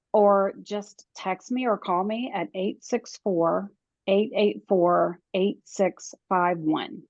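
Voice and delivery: dictating a phone number slowly; slow and clear. Shown as a demonstration. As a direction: spell out each digit, unhurried. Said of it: "nice and slow".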